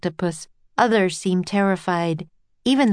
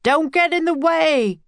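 high-pitched warning shout; 9 semitones higher, 3 dB louder than usual. A narrator's reading. Professor hurrying after the children, shouted warning. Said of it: "the professor shouted".

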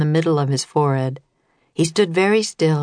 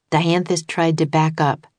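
ordinary delivery, nothing marked

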